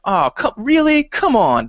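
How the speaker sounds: high, drawn-out protest; exasperated. Retold or imitated. Player reacting to a silly stealth rule, exasperated. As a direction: complain in disbelief, starting high and coming down.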